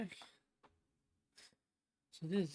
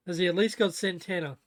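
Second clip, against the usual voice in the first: louder question to a housemate; raised voice to someone off mic; calling out; 10 dB louder than usual. Real, not acted. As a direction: ask it across the house.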